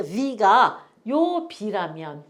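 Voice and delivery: ordinary talk, nothing marked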